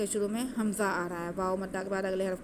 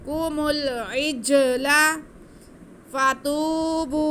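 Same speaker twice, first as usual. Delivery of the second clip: slow, drawn-out melodic tajweed recitation, higher and louder; Quran recitation; 5 semitones higher, 7 dB louder, slower than usual. Shown as a demonstration. Teacher demonstrating the recitation to students, slow melodic chant. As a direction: chant it slowly with nasal ghunna. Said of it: "इसे नहीं खींचना".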